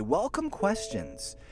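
big slow high announcement of "Welcome questions"; announcing the point. Shown as a demonstration. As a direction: sing out "Welcome questions" like a headline. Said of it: "moves me to point number three".

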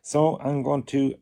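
normal talking voice

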